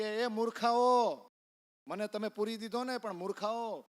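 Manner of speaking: a locked-in son shouting at his parents. Retold or imitated. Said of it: "બૂમ પાડતા પાડતા".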